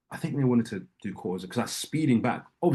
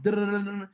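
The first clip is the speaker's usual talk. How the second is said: high, even beeping like an alarm; imitated alarm; 9 semitones higher, faster than usual. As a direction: sing out the alarm beeps. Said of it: "I start hearing an alarm".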